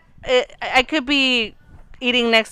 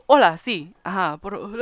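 a hint of a macho phone answer; quietly voiced masculine phone talk; mock masculine voice; 4 semitones lower, 3 dB quieter than usual. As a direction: lightly lower manly phone voice. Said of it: "you try to sound more masculine".

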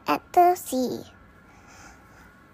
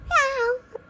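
much higher squeaky meow; cat meow; 9 semitones higher than usual. Acted. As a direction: answer like a little cat. Cat answers about milk.

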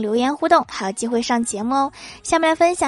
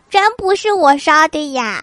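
put-on higher, louder, protesting child voice; pleading kid; 5 semitones higher, 5 dB louder than usual. Boy's exam answer read out, protesting innocence.

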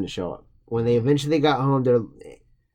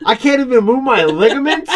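high, loud, laughing mock outrage; angry doll voice; 10 semitones higher, 6 dB louder than usual. Co-host voicing Robert the doll, high and laughing. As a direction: squeal it like an offended doll. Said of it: "Robert's just like".

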